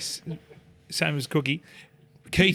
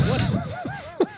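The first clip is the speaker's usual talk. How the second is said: stunned what. Retold or imitated. Stunned by the footy challenge.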